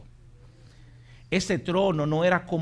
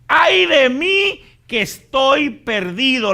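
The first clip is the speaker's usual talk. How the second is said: high, loud, slow wail of a guilty man; guilty man's cry; 11 semitones higher, 13 dB louder, slower than usual. Retold or imitated. Preacher voicing a guilty man's lament, high and loud. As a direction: wail it like a sinner undone. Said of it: "la respuesta de un hombre pecador".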